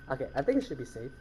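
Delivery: low, soft, quick aside; cautiously quiet. Real, not acted. Player thinks it should be safe, cautiously quiet. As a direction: mutter it warily, keeping your voice down. Cautious relief between scares.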